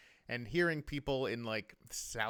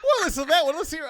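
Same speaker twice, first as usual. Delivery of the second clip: loud high laughing voice, much higher and louder; laughing tourist gawker; 12 dB louder than usual. Pretend. Friend playing a gawker at Boston locals, loud laughing. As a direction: cackle and point at the passerby, delighted.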